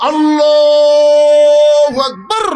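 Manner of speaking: loud, long-drawn chanted shout; calling out takbir. Acted. Shepherd interrupting the prayer to correct the imam, shouted takbir. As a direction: cry it out high, holding the note.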